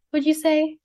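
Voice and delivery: normal talking voice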